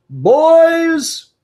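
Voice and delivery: high, loud summoning shout; calling out. Acted. Batman calls in the Robins, shouting high. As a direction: holler for your sidekicks, high and loud.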